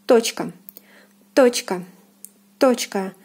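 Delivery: Точка with a full open O in the first syllable; Russian stressed O. Shown as a demonstration. Teacher repeats Точка for learners, stressed O. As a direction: hit the O of Точка clearly. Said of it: "the O is stressed and is pronounced as in the alphabet".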